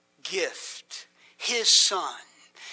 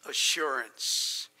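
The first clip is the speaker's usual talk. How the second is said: ordinary delivery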